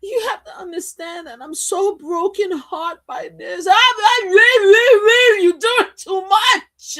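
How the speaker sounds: high, loud, sobbing wail; hammed-up high tearful shout; crying outburst. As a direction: burst into mock-sobbing protest. Acting Jane's tearful outburst.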